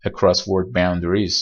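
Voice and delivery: across word boundaries said very slowly; slow careful term. Shown as a demonstration. Teacher naming the term, very slowly.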